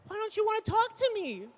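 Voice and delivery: high, soft mock-wife plea; pleading wife. Wife pleads with silent husband to talk, mock pleading. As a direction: imitate a hurt wife, softly pleading. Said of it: "your wife's like".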